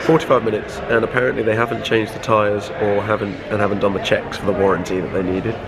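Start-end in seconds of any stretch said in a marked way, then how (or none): none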